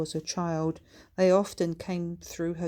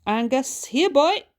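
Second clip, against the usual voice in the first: high, loud call to a dog; bright rising holler for a pet; 8 semitones higher, 10 dB louder than usual. A narrator's reading. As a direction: summon the dog from a distance, pitch rising sharply. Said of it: "she called Bertha".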